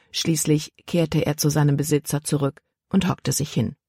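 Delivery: plain reading voice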